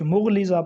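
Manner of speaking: مغلظہ as "mugh-liza"; another mispronunciation. Shown as a demonstration. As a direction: say مغلظہ with "u" first, single l. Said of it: "یہ درست نہیں ہے".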